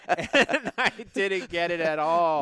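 laughing through the words. Real, not acted. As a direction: chuckle as you say it. Laughs while admitting defeat.

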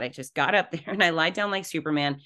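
ordinary talk

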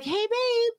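whiny call. Shown as a demonstration. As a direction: call out like a little bitch. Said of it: "Instead of being like".

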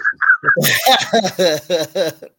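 real loud burst of laughter. Real, not acted. Host cracking up loudly at his own bit.

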